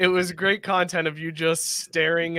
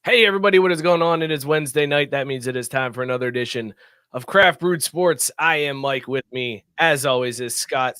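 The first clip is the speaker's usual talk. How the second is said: show host intro; 3 semitones lower, 4 dB louder than usual. Acted. Opens the show.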